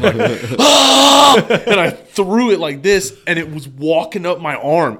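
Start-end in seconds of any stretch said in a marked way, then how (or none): 0.6-1.4 high piercing retold shriek; blood-curdling scream. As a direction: let out a high panicked scream. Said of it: "the blood curdling".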